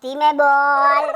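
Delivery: very slow, low-pitched command; slow, low, threatening order; menacing warning. Acted. Warns opponent to lower his voice.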